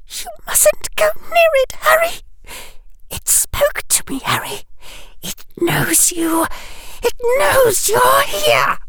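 very high, gasping frightened voice; terrified warning. A narrator's reading. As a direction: plead in terror, broken by gasps. Terrified girl warns Harry.

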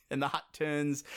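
ordinary talk, nothing marked

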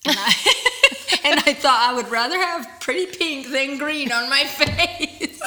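laughing about makeup that went green. Real, not acted. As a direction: laugh through the story.

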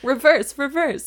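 bright mock rewind command; playful rewind call. Host rewinding her misread line.

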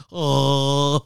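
amateur Wookiee call, loud; Chewbacca attempt. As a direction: push out a Chewbacca groan. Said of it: "I'm trying to do a Chewbacca".

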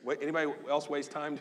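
usual speaking voice

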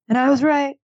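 loud, high gloating; triumphant. Real, not acted. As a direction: crow about being right. Gloating over a correct prediction.

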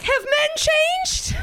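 very high, wide-eyed question; hammed-up hopefulness; mock naive hope. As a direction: ask it with squeaky, hopeful wonder. Acted naive hope about men.

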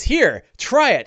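loud, high, brisk offer; high-voiced hearty urging; hearty Big Al. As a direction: call it out as you toss something. Big Al tosses over the armor.

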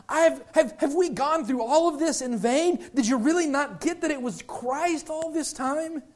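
high, incredulous string of questions; Paul beside himself. Acted. Paul asking if it was all in vain, acted exasperation. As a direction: plead in exasperated disbelief. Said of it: "because he's just beside himself".

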